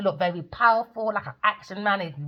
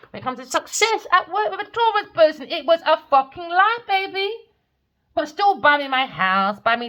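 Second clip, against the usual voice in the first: high mocking; 9 semitones higher, 5 dB louder than usual. Real, not acted.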